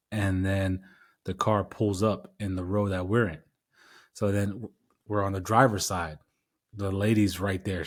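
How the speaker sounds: ordinary talk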